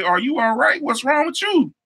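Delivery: high, sing-song fake concern; mock concern. To the glaring classmate, teasing high concern. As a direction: needle her with sweet, high innocence. Mocking coworker who glares at him.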